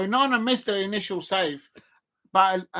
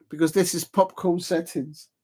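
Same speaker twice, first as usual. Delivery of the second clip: ordinary delivery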